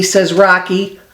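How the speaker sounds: ordinary delivery, nothing marked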